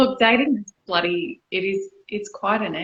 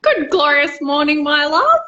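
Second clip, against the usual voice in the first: grand greeting; 5 semitones higher, 5 dB louder than usual. Acted.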